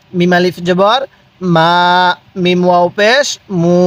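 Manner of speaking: lilting letter names, then "মা" held level; measured spelling chant with long "মা"; drawn-out vowels. Shown as a demonstration. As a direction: spell it out and lengthen "মু". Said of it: "পড়ুন আমার সাথে".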